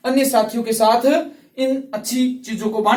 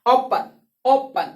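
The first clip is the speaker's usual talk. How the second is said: ओपन said very slowly, each sound drawn out; slow demo; 2 semitones higher, much slower than usual. Shown as a demonstration. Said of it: "थोड़ा सा अ आ रहा है". (ओपन को बहुत धीरे, खींचकर बोलें)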